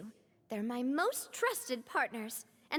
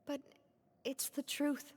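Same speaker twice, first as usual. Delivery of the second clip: much softer, heavy and sorry; quiet sorrow; 5 dB quieter than usual. Acted. Boss tells returning friend his comrades died, quietly grieving. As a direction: hold back grief while admitting it. Breaking news of deaths.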